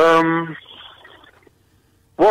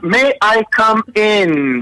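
slow, pitched-up courteous request; polite asking; 5 semitones higher, much slower than usual. Shown as a demonstration. Showing how to ask before entering, slow and polite. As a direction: ask nicely, drawn out and high. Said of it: "That's the nice word you should say".

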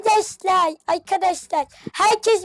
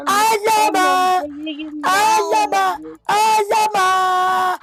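drawn-out call; much slower than usual. Acted. Repeated call in game.

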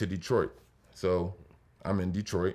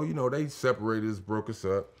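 ordinary talk

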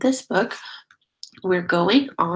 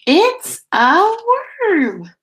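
a high, louder gasp of discovery; surprised reveal; 11 semitones higher, 5 dB louder, slower than usual. Acted. Teacher revealing the worm to her class, surprised. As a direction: make the children wonder what it is.

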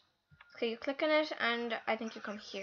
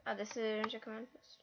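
normal talking voice

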